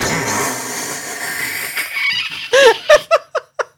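long high-pitched laugh. Real, not acted. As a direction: burst out laughing. Reacts to funny comment.

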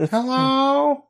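very high drawn-out cat hello; high meowing hello. Retold or imitated. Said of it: "He's like".

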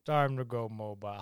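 low, soft villain impression; Tom Hardy. Co-host answers with another actor impression, low and quiet. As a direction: drop it down into a muffled film-character voice. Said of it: "How many Tom Hardy impressions".

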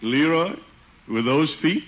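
ordinary talk

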